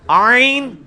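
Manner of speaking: عین drawn out with a strong throat onset; throaty ayn. Shown as a demonstration. Teacher correcting a student's attempt, emphatic throaty ayn. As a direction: sustain عین, squeezed from the throat. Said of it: "کدھر عین".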